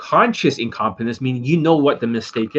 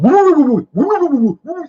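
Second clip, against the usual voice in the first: much higher and louder, rushed woo-woo babble; mocking fast gabble; 6 dB louder than usual. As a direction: parody someone racing through his words. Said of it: "he starts going".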